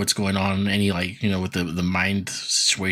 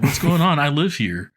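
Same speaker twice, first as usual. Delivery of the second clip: higher, louder mock surfer voice; Michelangelo shtick; 6 semitones higher, 4 dB louder than usual. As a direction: play a clueless dude protesting, pitched up. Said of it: "Michelangelo shtick".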